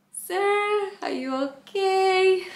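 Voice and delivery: high, slow, careful nervous question; nervously asking the hurt passenger, high and slow; nervous high politeness. Retold or imitated. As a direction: sweeten it to hide the panic. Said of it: "Now I'm freaking out".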